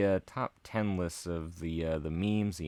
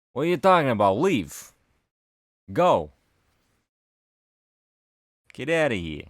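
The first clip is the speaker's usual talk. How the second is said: loud brush-off; 5 semitones higher, 7 dB louder than usual. Pretend. Telling off the devil.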